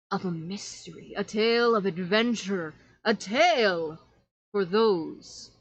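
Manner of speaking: lightly voiced showman with dramatic swells; a hint of a theatrical storyteller; horror host. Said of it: "in a very deep voice that I cannot do".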